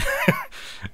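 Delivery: quick high burst of laughter; short laugh. Real, not acted. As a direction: let a real laugh slip out. Laughing at the screen.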